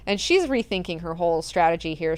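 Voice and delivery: usual speaking voice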